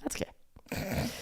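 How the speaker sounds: breathy whisper, slow. Real, not acted. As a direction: let it go in a whisper. Giving up softly.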